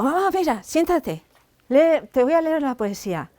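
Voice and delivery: high, eager call to mom; eager excitement. Retold or imitated. Teen daughter urging her mother to hear her poem, high and eager. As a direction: bubble over wanting her to listen.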